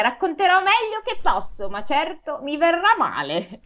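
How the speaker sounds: high-pitched crow making a disclaimer; high storybook bird voice; crow character. Acted. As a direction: apologize ahead as the crow, voice up high. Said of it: "disse la cornacchia".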